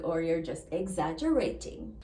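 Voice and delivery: usual speaking voice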